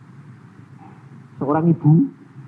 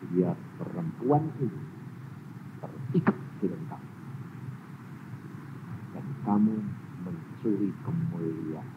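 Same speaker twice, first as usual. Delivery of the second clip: God's voice; 4 semitones lower, 7 dB quieter than usual. Retold or imitated. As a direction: speak as patient God. God's soft explanation. (suara Tuhan yang tenang)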